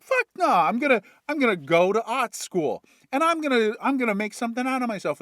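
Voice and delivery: defiant yell. Acted. Kid rebels against dad.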